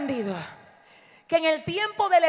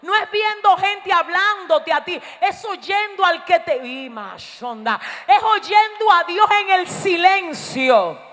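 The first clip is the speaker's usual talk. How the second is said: higher-pitched, emphatic sermon delivery; raised-voice preaching; 5 semitones higher, 7 dB louder, slower than usual. Real, not acted. Preacher teaching church to hear God in silence, raised-voice preaching. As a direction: stretch the key phrases with a lifted voice.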